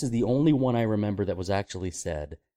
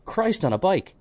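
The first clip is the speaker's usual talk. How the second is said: Irish saying; 9 semitones higher, 3 dB louder than usual. Pretend. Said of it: "a saying everyone should say more".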